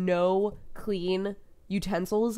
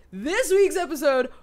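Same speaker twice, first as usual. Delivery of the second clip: loud, high gushing; excited rave; 9 semitones higher, 7 dB louder, slower than usual. Real, not acted. Raving about the Hannibal episode, loud and high. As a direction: burst out with excitement.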